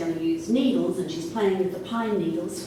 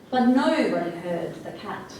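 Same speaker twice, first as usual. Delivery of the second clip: ordinary delivery, nothing marked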